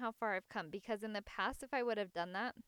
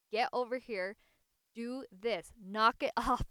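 loud, sharp scolding with a laugh at the end; yelling; 8 dB louder than usual. Retold or imitated. Mother yelling at her tantruming son, loud scolding. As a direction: bark it like a parent at the last straw. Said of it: "So I yelled at him".

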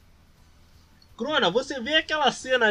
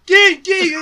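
mocking surprise; 10 semitones higher, 11 dB louder than usual. GM mocking a plea for help.

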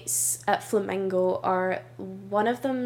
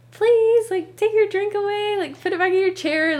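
high, loud pleading call; imitated lifeguard shout; 11 semitones higher, 6 dB louder than usual. Lifeguard shouting at a guest to move their drink, imitated shout. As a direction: call out insistently to a guest across a pool. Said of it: "shouting at someone to, like, take their drink out".

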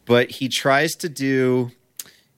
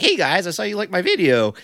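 higher, eager mock voice of the guy; 7 semitones higher than usual. Podcaster mocks an eager fan, higher chirpy voice. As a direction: parody his chirpy greeting. Mocking the guy's message.

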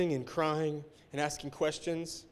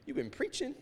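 God's rebuke; 5 semitones higher, 4 dB louder than usual. Retold or imitated. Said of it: "God was saying".

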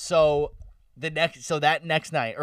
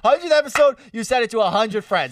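laughing; 8 semitones higher, 6 dB louder than usual. Real, not acted. Laughing while pitching his idea.

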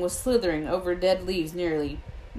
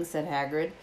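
usual speaking voice

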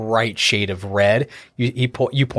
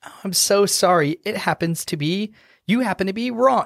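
higher, fake-sorry gloating; smug halfling gloat; 9 semitones higher than usual. Halfling gloating at the dwarf, mock sorry and higher. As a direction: apologize while clearly enjoying the win. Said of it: "Rogue the halfling goes".

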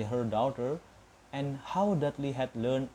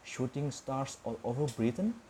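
plain reading voice

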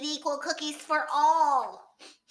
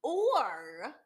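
deep hippo voice; 9 semitones lower, 6 dB quieter, slower than usual. Acted. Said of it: "says the hippopotamus".

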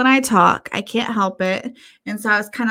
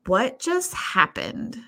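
bewildered; 7 semitones higher than usual. Retold or imitated. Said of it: "left standing there like".

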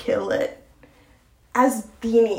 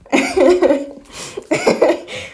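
real laugh; 6 semitones higher, 3 dB louder than usual. Real, not acted. Laughing at people talking.

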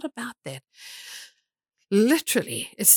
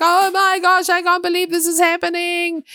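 mock panicked cry, much higher and louder; hammed-up panic; 11 semitones higher, 6 dB louder than usual. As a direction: shriek it like a hammed-up alarm. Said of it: "I'm like".

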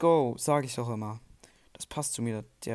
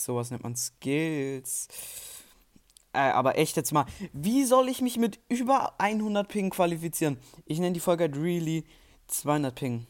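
ordinary talk

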